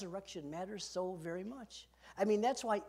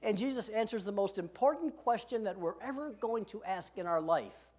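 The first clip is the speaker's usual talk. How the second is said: ordinary talk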